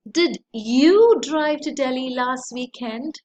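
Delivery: YOU punched loud, rising question; stress on YOU. Shown as a demonstration. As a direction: hit the word "you" hard. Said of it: "stressing at different places".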